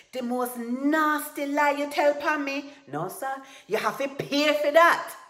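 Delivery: high, very loud threat; furious roar. A narrator's reading. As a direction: bellow it in outrage. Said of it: "Tiger roared".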